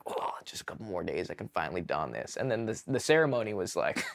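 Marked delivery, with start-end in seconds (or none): 0.1-2.4 hushed longing. Retold or imitated. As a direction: lower and softer, to himself. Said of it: "holding it in my hand and being like".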